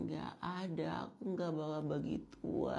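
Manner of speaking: soft, mumbly pick-me voice; soft spoken. Acted. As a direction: murmur like you're too delicate to speak up. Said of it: "so dipelan-pelanin suaranya".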